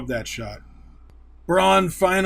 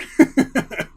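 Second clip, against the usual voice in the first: laugh; 9 semitones higher, 6 dB louder than usual. Real, not acted. Laughing at own excitement.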